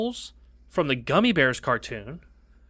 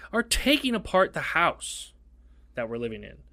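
normal talking voice

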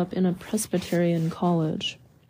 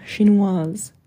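French word repeated with a questioning rise; 4 dB louder than usual. A narrator's reading. Villagers repeating the word to each other, French with a rise. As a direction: echo it in French, lifting at the end. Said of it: "Then, in lower tones".

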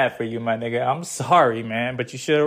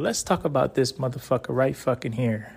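ordinary delivery

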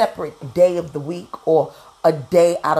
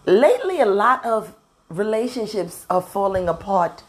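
ordinary talk, nothing marked

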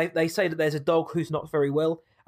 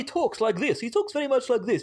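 higher, mock-posh Queen's English accent; 8 semitones higher than usual. Mocking how the English dub made a regular Spanish guy sound, in a Queen's English accent. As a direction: send up the dubbed guy's stiff Queen's English accent. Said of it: "very Queen's English".

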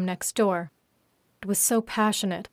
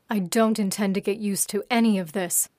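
plain reading voice

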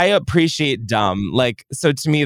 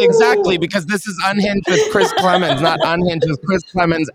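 mock diva boast; 6 semitones higher, 3 dB louder than usual.

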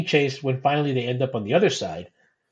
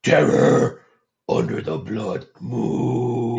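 caveman voice; much slower than usual. Pretend. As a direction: drag it out like a caveman. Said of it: "Say that in caveman voice".

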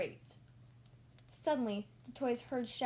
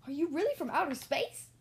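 higher, louder eager question; very excited; 5 semitones higher, 5 dB louder than usual. Acted. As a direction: gush with wonder. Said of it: "got very excited".

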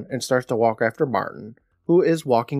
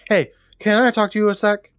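calling after him; 8 semitones higher than usual. Retold or imitated. Kelly's line acted after walking after him.

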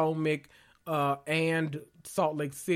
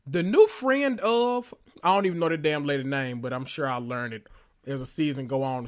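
ordinary talk

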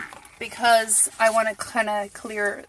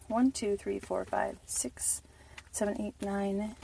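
whispered counting; 2 semitones lower, 13 dB quieter, slower than usual. Real, not acted. Counting nuggets under breath.

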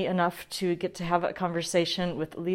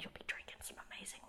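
whispering; 25 dB quieter, much faster than usual. Real, not acted. Whispered aside about the wine.

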